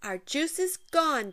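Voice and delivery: put-on child near tears over spilled juice; sniffly sad. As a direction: whimper it like a crushed kid. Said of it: "with a sniffle".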